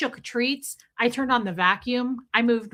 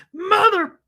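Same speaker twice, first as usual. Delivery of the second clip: high, loud mock-furious cry; exasperated curse; 8 semitones higher, 8 dB louder than usual. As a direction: burst out as if cursing the cat. Retells cursing on finding cat alive.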